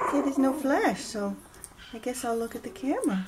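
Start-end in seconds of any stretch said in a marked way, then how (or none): none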